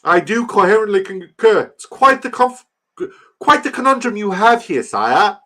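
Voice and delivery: loud, very high mock-English pomp; hammed-up English accent. Streamer concurring with his sire, hammed-up English accent. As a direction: declaim like an overdone English courtier, high and loud. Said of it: "I'm done with the English accent".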